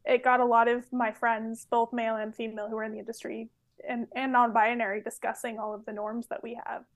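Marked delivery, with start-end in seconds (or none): 5.0-6.8 drops to an unvoiced whisper at the end; trailing whisper. Real, not acted. Anthropologist trailing off to podcast hosts, whispering. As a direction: let it fade into a breathy hush.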